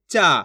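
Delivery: चा held long with a falling tune. Shown as a demonstration. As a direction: stretch चा out and let it fall. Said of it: "खींच के पढ़ देंगे". (चा का आ खींचकर)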